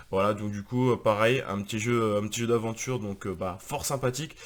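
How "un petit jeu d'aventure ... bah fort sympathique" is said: usual speaking voice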